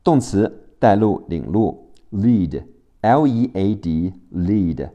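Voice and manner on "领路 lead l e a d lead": ordinary talk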